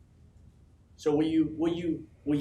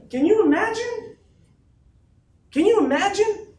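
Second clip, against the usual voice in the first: a slow, booming, much higher shout with a laugh; 10 dB louder, slower than usual. Real, not acted. Speaker to his team, laughing and roaring the question. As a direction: roar it through a laugh.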